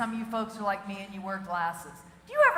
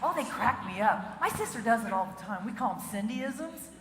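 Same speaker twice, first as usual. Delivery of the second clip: ordinary talk, nothing marked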